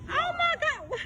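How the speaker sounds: high-pitched oh my God of disbelief; shocked cry at what she's watching; stunned gasp. Real, not acted. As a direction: let it jump way up in shock. Reacting to the clip in disbelief.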